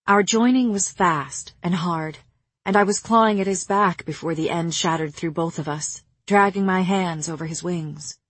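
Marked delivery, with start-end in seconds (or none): none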